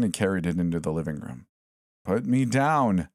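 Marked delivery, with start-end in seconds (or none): 2.0-3.1 slow, higher protest, words drawn out; a stretched-out plea from the toy; mannequin crying out. A narrator's reading. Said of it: "the mannequin cried".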